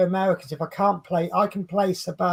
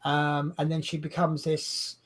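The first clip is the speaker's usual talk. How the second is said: ordinary delivery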